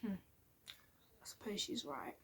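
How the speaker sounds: very slow, hushed self-talk; gone quiet. Retold or imitated. Daughter after mum's telling-off, subdued murmur. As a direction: retreat into a small, reluctant near-whisper. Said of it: "I went quiet".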